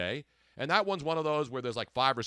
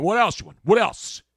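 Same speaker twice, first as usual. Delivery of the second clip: louder, higher street-vendor bark, half in jest; a playful mock of a market guy hawking zucchinis; brash Ninth Street produce seller, lightly voiced; South Philly vendor; 6 semitones higher, 8 dB louder than usual.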